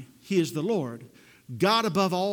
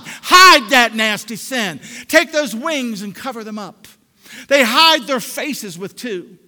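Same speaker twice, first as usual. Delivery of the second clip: driving, emphatic pulpit delivery; forceful preacher hitting key words hard; full-force preaching; 3 semitones higher, 7 dB louder than usual. Real, not acted. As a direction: build it in insistent repeated phrases.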